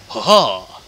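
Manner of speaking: belted triumphant ha ha; over-the-top victory laugh; loud mock villain laugh. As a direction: bellow a hammy villain cackle. Mocking enemy triumph laugh.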